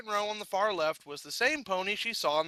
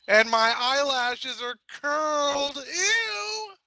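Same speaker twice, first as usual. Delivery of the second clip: high, slow, whining disgust; disgusted whine; 8 semitones higher, much slower than usual. A narrator's reading. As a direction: wail at her own curled lashes. Horrified by her looks.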